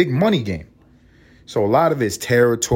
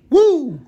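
woo; 6 dB louder than usual. Retold or imitated. Sudden flare of violence.

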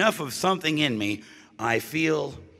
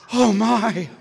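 prim shock; 9 semitones higher, 4 dB louder than usual. Retold or imitated. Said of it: "you'd be like".

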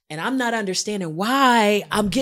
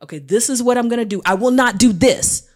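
loud, emphatic commitment; imitating a partner's insistent pledge; firm promise; 3 semitones higher, 8 dB louder than usual. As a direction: spell out the rule forcefully.

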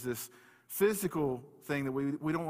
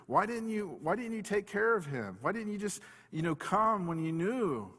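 mildly upset; 6 semitones higher than usual. Retold or imitated. Mourners questioning Jesus over Lazarus's death, mildly upset. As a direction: imitate mourners' mild reproach. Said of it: "they're just kind of upset at Jesus".

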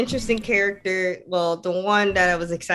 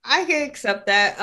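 shrugging acceptance; 8 semitones higher than usual. Retold or imitated. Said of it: "So I was like".

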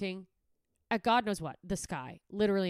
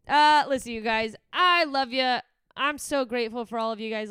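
big warm sign-off; 4 semitones higher, 10 dB louder than usual. Real, not acted. Emphatic sign-off to listeners.

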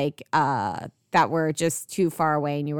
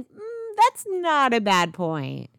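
drawn-out, higher-pitched reluctant agreement; mulling concession; 7 semitones higher, slower than usual. Retold or imitated. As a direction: slowly concede it, starting on a long high "Mm". Retelling own grudging reaction to complaints.

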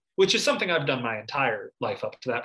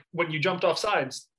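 usual speaking voice

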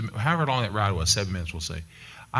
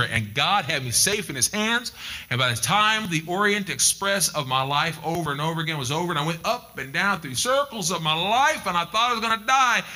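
high, building preacher's voice; impassioned pulpit preaching; rising preaching; 8 semitones higher than usual. Acted. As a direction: climb into full sermon fervor. Preaches at full force.

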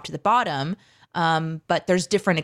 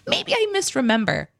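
mock self-doubt. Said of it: "I'm like".

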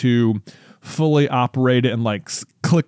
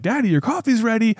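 put-on high, chirpy kid call; imitating a little boy; 10 semitones higher than usual. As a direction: announce it like a proud small child. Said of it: "come running ... and be like".

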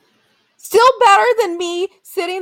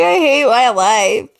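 mock crying; 4 semitones lower, 5 dB louder, much slower than usual. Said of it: "Because I was crying hysterically".

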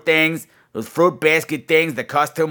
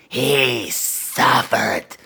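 "He suffered" stretched very slow with relish; drawn-out gloat; 3 semitones lower, much slower than usual. Acted. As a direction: drag out "He suffered" with evil pleasure. Repeated, drawn out.

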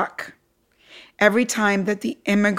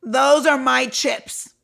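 stern boss; 4 semitones higher, slower than usual. Retold or imitated. Boss scolds crying young employee.

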